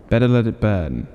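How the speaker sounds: ordinary delivery, nothing marked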